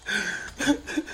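high, quiet choked sobs; real sobbing. Real, not acted. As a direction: break down into helpless sobs. Breaks down while recounting confession.

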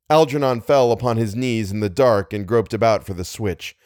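ordinary delivery, nothing marked

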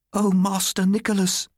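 high-pitched lamenting cry. A narrator's reading. Martha to the bereaved boy, crying out. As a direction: wail the name, pitched far up. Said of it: "she cried".

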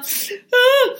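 a sudden high wailing sob, shown as a demo; demo snot crying. Shown as a demonstration. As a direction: act out a big ugly cry in one burst, high. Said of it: "like this".

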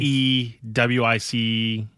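slow letter-by-letter spelling. Real, not acted. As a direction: spell each letter slowly. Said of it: "Can you spell Dub".

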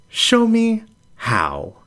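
high, slow, disbelieving ask; startled question. Acted. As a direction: pitch it up in shock.

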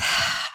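audible breath let out; re-enacted steadying sigh; deep breath. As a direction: breathe out softly before answering. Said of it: "After a deep breath".

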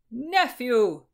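loud, high hearty call; hearty greeting. A narrator's reading. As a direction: boom a cheerful greeting through the door. Uncle greets nephew loudly.

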